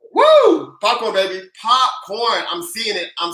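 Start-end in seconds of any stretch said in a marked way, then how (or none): 0.1-0.8 quick piercing "woo"; high loud whoop. Real, not acted. Host cheering viewers' popcorn emojis, high whoop.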